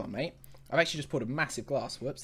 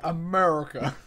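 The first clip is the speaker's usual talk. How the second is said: loud, laughing mock-American echo; hammed-up American accent; 5 dB louder than usual. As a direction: parody an American saying it.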